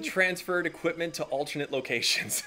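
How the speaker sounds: laughing, bureaucratic euphemism for stealing; tongue-in-cheek formal phrasing; mock-formal euphemism. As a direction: say it like an official report, amused. Joking euphemism for theft.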